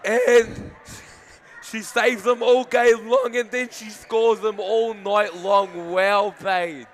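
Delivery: excited. Real, not acted. Commentator thrilled after winning penalty.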